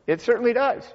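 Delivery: ordinary talk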